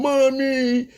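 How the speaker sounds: high babyish fake-crying wail; crying kid. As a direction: whimper it like a little kid. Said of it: "the kid's crying, going".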